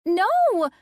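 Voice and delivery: put-on high, loud child's shriek. Addison shrieking as Colin swipes at John. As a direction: let out a giggling scream. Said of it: "Addison shrieked".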